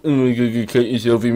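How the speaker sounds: mock them like a doddering old dinosaur; geriatric T-Rex. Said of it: "geriatric T-Rex going on there".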